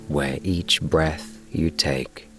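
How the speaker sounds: usual speaking voice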